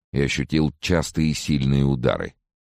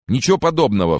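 high, loud denial; outraged raised-voice retort; indignant flare-up; 10 semitones higher, 6 dB louder than usual. A narrator's reading. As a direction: jump in, protesting hotly. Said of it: "вскинулся Фрэнсис".

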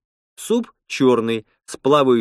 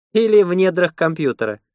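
higher-pitched, lightly scoffing retort; scornful snort; 7 semitones higher, 3 dB louder than usual. A narrator's reading. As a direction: toss it back as a mild scoff, pitched up. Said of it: "фыркнула Лена".

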